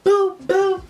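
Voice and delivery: two high, loud boom noises; imitated punches. Got him two good times, high punchy booms. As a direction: sound out two quick blows.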